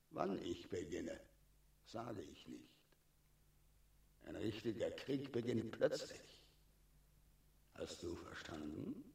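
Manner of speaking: quiet menace. Acted. Dragon quietly threatening Lancelot before the fight. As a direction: drop the voice to a threatening hush.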